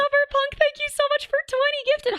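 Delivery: high, bright thank-you shout-out; excited thanks. Real, not acted. Streamer thanking a gifter on stream, excitedly high. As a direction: gush a big thanks to the gifter.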